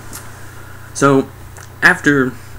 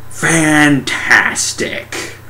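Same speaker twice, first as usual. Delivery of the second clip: drawn-out, higher mock praise; sarcastic approval; 6 semitones higher, much slower than usual. Recapping the car crash, sarcastic. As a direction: stretch it out in sarcastic delight.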